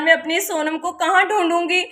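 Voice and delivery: usual speaking voice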